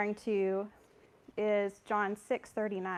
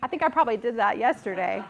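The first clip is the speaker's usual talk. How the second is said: laughing; 8 dB louder than usual. Real, not acted. Laughing at her own confession.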